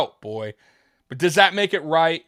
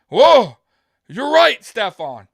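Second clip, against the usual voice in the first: loud, higher mock "whoa" of sudden realisation; mock surprise; 5 semitones higher, 9 dB louder, slower than usual. Mocking Josh being set right by Stefon, loud mock surprise. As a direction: ham up a sarcastic eureka. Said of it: "Josh all gonna be like".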